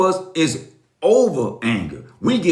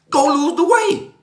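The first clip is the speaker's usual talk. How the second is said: emphatic command; 12 semitones higher than usual. Real, not acted. Preaching at viewers about doctor's advice.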